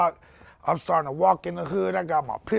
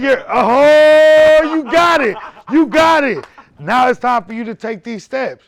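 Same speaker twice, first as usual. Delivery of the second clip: loud, high shouted encouragement; mock cheering coach; 9 semitones higher, 14 dB louder than usual. Man cheering on a friend learning to walk, loud excited shout. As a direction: yell it like a coach pushing a patient.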